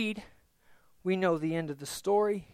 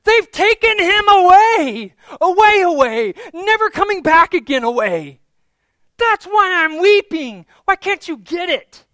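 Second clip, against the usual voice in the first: very high, loud, frustrated wail of words; anguished shout; 10 semitones higher, 12 dB louder than usual. Acted. Mary cries that her Lord is taken away, shouting in anguish. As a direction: cry out at full volume, desperate to be understood. Mary's acted lament at tomb.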